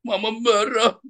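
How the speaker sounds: high-pitched imitation of a child's tantrum; Miracle. Imitating a child who was just told off, mock tantrum. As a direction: mimic a protesting child, pitched well up. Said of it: "si Wise apa si Miracle suka gitu dulu".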